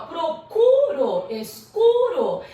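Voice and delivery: "Procuro, escuro" loud and very drawn out; stressed rhyme endings. Shown as a demonstration. Teacher showing amplified rhyme, "Procuro, escuro" slow and emphatic. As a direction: stretch "Procuro" and "escuro" loudly on the rhyming syllable. Shows the rhyming word endings.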